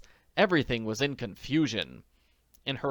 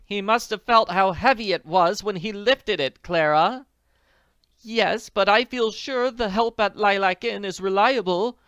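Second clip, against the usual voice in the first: high, louder genteel lady; higher lady voice; 9 semitones higher, 7 dB louder than usual. Acted. Two ladies at lunch, high genteel voices.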